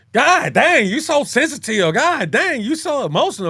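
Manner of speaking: loud, high, fed-up exclamation; exasperated outburst. Pretend. Man fed up with a sensitive woman, loud and exasperated. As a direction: throw your hands up and blast it out.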